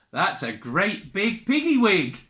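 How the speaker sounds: ordinary delivery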